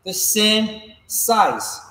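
the same size spoken slowly, with weight on same; patient coaching emphasis; slow, stressed same. Shown as a demonstration. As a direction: lean on same and let it fall. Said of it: "I try to keep both steps".

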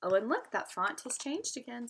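ordinary delivery

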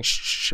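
sharp double "shh"; dad's shushing. Retold or imitated. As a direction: imitate a father shushing someone. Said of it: "my dad just keeps saying".

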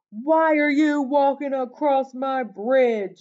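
loud, high-pitched troll demand; big storybook troll holler; troll's yell. A narrator's reading. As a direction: yell at the intruder as a nasty troll. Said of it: "yelled the hideous".

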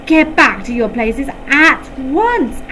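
bellowed order. A narrator's reading. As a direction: bellow at the class to sit down. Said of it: "he bellowed".

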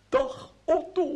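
mock her sweet hello, only a hint of a woman's voice; mimicking her greeting. Otto quoting the girl's hello to the baron, lightly mimicked. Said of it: "Ze zei".

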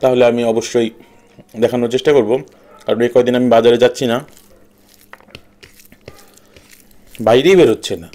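speaking with food in mouth; eating between words; talking while chewing. Real, not acted. Seen 0: Eats rice by hand between phrases; mouth seems partly full while speaking.

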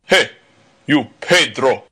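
bully's mild roar. A narrator's reading. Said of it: "he roared".

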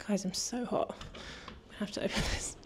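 breathless from the heat; puffing, short of air; out of breath. Real, not acted. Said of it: "Hot and I'm out of breath".

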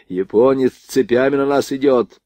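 plain reading voice

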